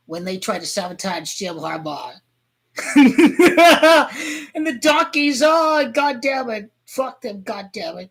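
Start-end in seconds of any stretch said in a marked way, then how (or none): 2.8-4.5 loud burst of laughter; big real laugh. Real, not acted. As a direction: cackle out loud at the rival. Laugh during fan rant.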